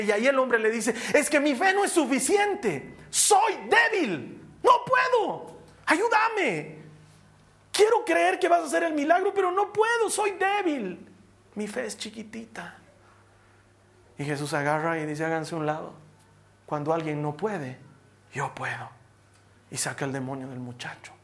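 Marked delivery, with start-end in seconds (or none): 1.1-11.1 high-pitched, drawn-out begging cry; desperate plea. Acted. Desperate father begging Jesus. 11.6-12.8 whispered, much softer admission; tiny confession. Acted. Father's last tiny admission. 15.2-18.9 low, unhurried assurance; calm authority. Acted. Jesus calmly takes charge.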